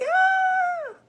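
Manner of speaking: high startled scream, loud; kid's scared yelp. Retold or imitated. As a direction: shriek like a frightened little kid. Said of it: "I was like".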